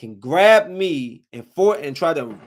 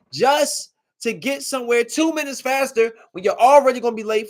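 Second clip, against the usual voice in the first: angry rant; 7 semitones higher, 5 dB louder than usual. Real, not acted. Ranting at reckless drivers.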